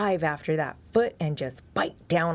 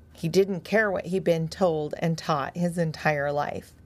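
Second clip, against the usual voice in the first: ordinary delivery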